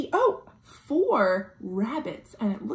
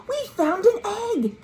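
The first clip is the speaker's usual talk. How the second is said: excited find; 11 semitones higher than usual. Acted. Finding egg under flap.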